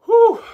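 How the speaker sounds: big breathless exhale; loud whew. Real, not acted. Exhale after long talking.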